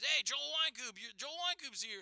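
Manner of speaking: high, eager call of his name; excited greeting. Retold or imitated. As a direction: greet him like a fan spotting a star. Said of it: "and he goes".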